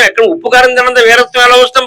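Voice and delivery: usual speaking voice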